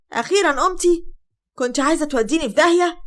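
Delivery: high, loud scolding; mother-in-law scolding the fainted bride; angry scolding. Acted. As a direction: snap at her for the scare.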